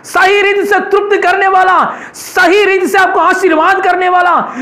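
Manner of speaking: preacher's climax; full-force preaching. Acted. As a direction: lift the congregation with praise.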